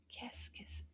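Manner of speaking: put-on hushed, secretive question to the kids; playful whispered quiz question.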